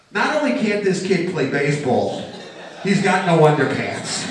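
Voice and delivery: loud exasperated dad; exasperated father. Retold or imitated. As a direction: bark it like a fed-up father. Father's reaction imagined.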